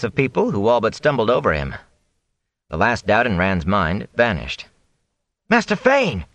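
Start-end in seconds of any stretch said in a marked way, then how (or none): 5.5-6.3 high, urgent call across a crowd; shout. A narrator's reading. Rand hails the fleeing peddler in the street, shouting. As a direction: call out high to catch his attention. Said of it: "he shouted".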